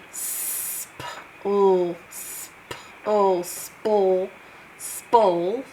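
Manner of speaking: "Sp-ul" stretched apart, then pushed closer each time until it makes "spole."; blending sounds. Shown as a demonstration. Teacher blending sp for pupils, stretched then joined. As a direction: slowly merge the blend into one sound. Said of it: "This is a blend".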